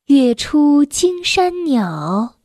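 very slow, measured classical poem reading; poetry recitation. Acted. Reader reciting a Tang poem to a child, slow declamation. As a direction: draw out each syllable, pause after every phrase.

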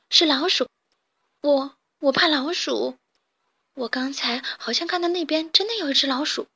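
scared, half-whispered worry; a touch of fear. Acted. Kitten admits to Carmen he fears mice, scared half-whisper. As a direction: fearfully confess the mouse. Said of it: "小黑猫有点害怕地说".

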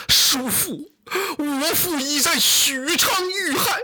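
high, slow words through sobs; tearful cry. Acted. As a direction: tell the news weeping. 马超向韩遂哭诉父亡. (哭着诉说)

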